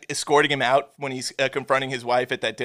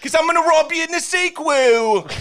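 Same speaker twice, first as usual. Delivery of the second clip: high, loud mock cockney accent; bad British accent; 10 semitones higher, 3 dB louder than usual. Mocking the actor's bad British accent, hammed-up cockney. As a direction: ham up a terrible British accent. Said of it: "British accent at the end".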